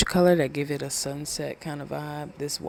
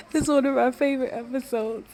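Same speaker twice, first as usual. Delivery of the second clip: much higher and louder, bursting with joy; excited gush; 11 semitones higher, 6 dB louder than usual. Real, not acted. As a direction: squeal about a beloved episode. Gushing about favorite episode.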